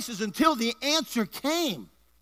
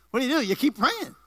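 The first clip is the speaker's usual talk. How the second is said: higher, driving preacher's refrain; emphatic preaching; 5 semitones higher, 3 dB louder than usual. Real, not acted. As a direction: hammer it home to the congregation.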